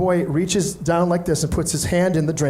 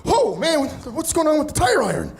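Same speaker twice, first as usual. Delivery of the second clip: Jeff's voice; 10 semitones higher than usual. Retold or imitated. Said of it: "he goes".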